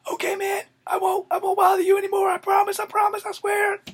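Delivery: hammed-up bully shrieking promises to his captor; terrified pleading. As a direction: squeal for mercy in panic. Beaten bully begging to be released.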